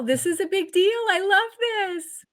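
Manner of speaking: excited gush. Real, not acted. Host tells guest he loves Bob's return, high delighted gush. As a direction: let the joy spill over on love. Host gushing about VeggieTales cast.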